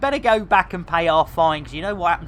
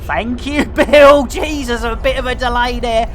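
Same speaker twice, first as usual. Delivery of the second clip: ordinary talk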